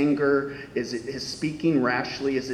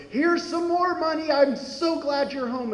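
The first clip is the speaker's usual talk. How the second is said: joyful father; 12 semitones higher than usual. Retold or imitated. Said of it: "dad goes".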